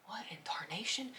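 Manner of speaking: breathy hushed disbelief, almost no voice; stunned whisper. Real, not acted. Alone at night hearing booms, whispering in shock.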